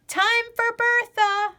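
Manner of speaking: high, loud call across the barn; calling out to Dad over the cows; yell. A narrator's reading. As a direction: shout it out to Dad down the row. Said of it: "I yell to Dad".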